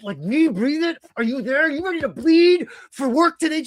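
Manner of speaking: over-the-top hype shout; manic Moxley climax. Retold or imitated. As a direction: peak the zealot pitch. Moxley impression peaks.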